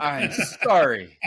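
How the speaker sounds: drawn-out disbelieving sorry; incredulous. Real, not acted. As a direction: say it like you can't believe it. Incredulous reaction to absurd sale.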